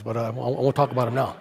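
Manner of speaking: normal talking voice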